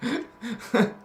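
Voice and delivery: a warm demonstration laugh; bright laugh. Shown as a demonstration. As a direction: laugh to show a real smile. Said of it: "I will show you a real smile".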